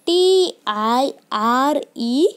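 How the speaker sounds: each letter spaced out slowly; slow dictation of letters; slow spelling. Shown as a demonstration. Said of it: "teacher spells word for class".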